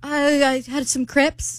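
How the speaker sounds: slow, high fake British; badly faked British, drawn out; British accent. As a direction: labour through a bad British accent. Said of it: "Our accents suck".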